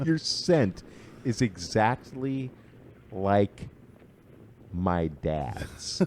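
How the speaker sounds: slow, each word spaced out; savoring a creepy line, drawn out; slow deliberate repeat. Shown as a demonstration. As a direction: lean on every word of the line, slowly. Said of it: "quote repeated slowly for effect".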